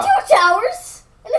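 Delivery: high, loud eager call; real excited call-out. Real, not acted. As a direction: announce it with thrill. Child excited listing finds.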